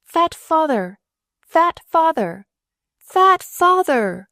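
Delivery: high, loud, slow taunting yell; taunting scream. A narrator's reading. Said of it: "she gibed and taunted".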